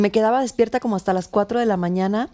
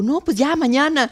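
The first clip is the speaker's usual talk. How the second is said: higher, lightly excited quote; mock eager reply; 6 semitones higher than usual. Actress asked when she'll return, lightly acted eagerness. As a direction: blurt it out, a touch eager. Eager answer about going back.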